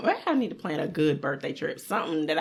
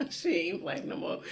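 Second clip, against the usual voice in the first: giggling through the words; laughing; 8 dB quieter than usual. Real, not acted. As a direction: laugh while repeating it. Repeats friend's quote laughing.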